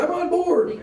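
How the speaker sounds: high, eager fiance voice; mock-eager fiance agreeing. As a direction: chirp it like a keen fiance. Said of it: "She goes".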